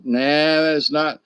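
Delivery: usual speaking voice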